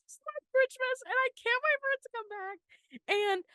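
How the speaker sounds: choking up as she gushes; voice breaking. Real, not acted. Gushing to cohosts about Christmas, voice cracking into tears. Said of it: "I'm gonna cry thinking about it".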